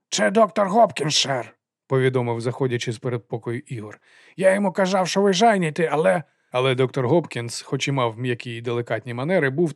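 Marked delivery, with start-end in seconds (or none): 0.1-1.6 high, loud lisping henchman; lisping servant announcing. A narrator's reading. 4.4-6.2 high lisping henchman making excuses; flustered lisping servant. A narrator's reading. Lisping servant excuses himself to master.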